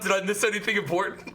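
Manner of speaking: normal talking voice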